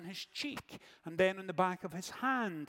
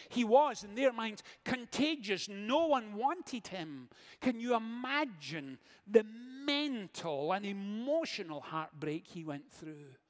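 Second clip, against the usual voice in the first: ordinary talk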